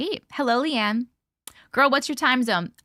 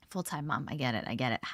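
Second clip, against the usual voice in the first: much lower, soft knowing aside; low quiet aside; 11 semitones lower, 10 dB quieter, faster than usual. Real, not acted.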